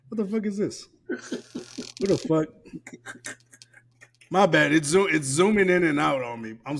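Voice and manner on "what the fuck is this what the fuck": disbelieving laugh through the words; laughing disbelief. Real, not acted. Streamer laughing at his zooming screen, disbelieving. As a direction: laugh it out, can't believe the screen.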